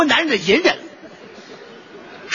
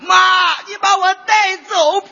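mock funeral wail; 8 semitones higher than usual. Child wailing at mother's funeral, hammed-up sobbing shout. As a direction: overplay the grieving child howling for mom.

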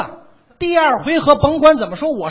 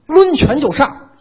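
mock charge; 3 semitones higher, 7 dB louder, slower than usual. Acting out the big guy's charge.

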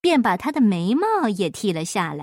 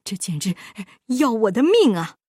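lamenting. A narrator's reading. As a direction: groan as if the price kills him. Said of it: "巴依老爷哀叹".

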